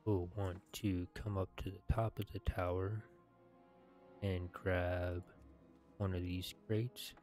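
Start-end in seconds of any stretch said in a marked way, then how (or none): none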